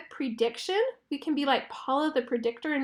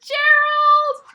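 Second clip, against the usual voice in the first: high, loud shout of his name; excited call; 13 semitones higher, 10 dB louder than usual. A narrator's reading. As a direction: call out Gerald bursting in. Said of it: "She comes springing in and she says".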